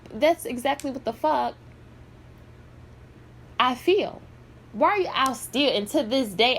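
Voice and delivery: ordinary talk, nothing marked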